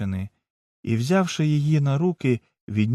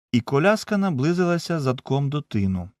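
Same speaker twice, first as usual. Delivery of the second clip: ordinary delivery, nothing marked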